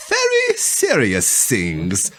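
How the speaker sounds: put-on "Very serious things" drawn out very slowly in a heavy German accent; dramatic German accent. Reading the German's ominous line to friends, "Very serious things" in a slow German accent. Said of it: "The young German went on dramatically".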